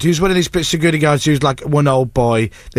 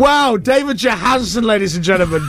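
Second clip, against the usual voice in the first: sarcastic fanfare; 5 semitones higher than usual. Pretend. Irked presenter mocking producer's celebrity sighting, sarcastic fanfare. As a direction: introduce the star with fake awe.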